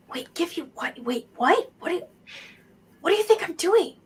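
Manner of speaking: put-on confused kid, rising to a high voice; spooked kid. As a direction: question the stranger warily as a child. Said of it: "he spooks and sees you".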